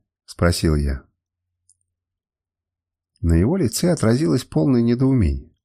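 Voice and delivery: usual speaking voice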